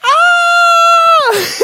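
put-on high shrieking scream of a frightened toddler; sudden piercing child's shriek. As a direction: shriek like a scared little girl.

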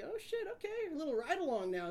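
high-pitched surprised acceptance; mock pleasant surprise. Driver invited to the squad car's front seat, high mock surprise. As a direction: jump up in pitch, playing along. Acted surprised reply to the cop.

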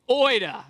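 oida chanted loud as OY-duh; over enunciating. Real, not acted. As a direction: call out oida, both syllables clear. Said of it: "Everybody say oida".

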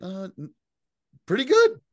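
very high, hesitant reply; stall, then squeak out an unsure answer; retold Data. Newly human Data answering Riker, retold high and hesitant. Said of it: "He's like".

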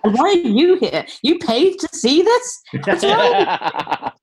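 high, swooping, laughing disbelief; mock incredulity. Performer turning on the paying audience, mock incredulity. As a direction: challenge them in a drawn-out, squeaky-high mock outrage.

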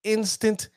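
"instant" alone, pitched high for emphasis; pointed emphasis. Shown as a demonstration. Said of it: "notice how I'm saying that".

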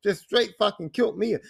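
ordinary delivery

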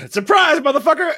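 spring it on them with a yell; gleeful shout. Acted. Host greeting the child bursting in, gleeful yell.

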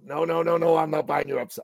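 in-your-face guy. Retold or imitated. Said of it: "he just got in my face".

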